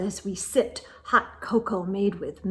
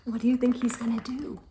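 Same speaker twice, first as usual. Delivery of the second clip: usual speaking voice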